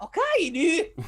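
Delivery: loud, high mock-Scottish catchphrase; Scottish attempt with a laugh; attempted Scottish accent. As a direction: proudly overdo a bad Scottish accent. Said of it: "puts it all together like you did".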